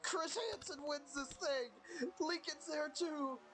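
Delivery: wrestling commentator. Acted. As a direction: call the race like a ringside announcer. Final laps called, pitch up.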